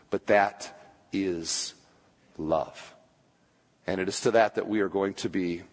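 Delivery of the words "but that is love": hushed emphasis. Real, not acted. Dramatic hushed climax of sermon point.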